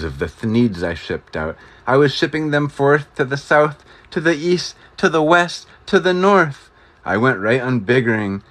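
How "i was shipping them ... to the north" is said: climbing ever higher and louder; rising to the top like a sales boast; storyteller on a gleeful climb; building excitement. A narrator's reading. Climbing list of directions.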